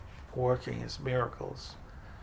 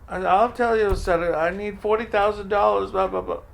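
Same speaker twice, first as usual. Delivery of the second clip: louder, raised-pitch venting; upset outburst; 6 semitones higher, 8 dB louder than usual. Retold or imitated. Answering the visitor, venting about money loudly. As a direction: blurt out the frustration.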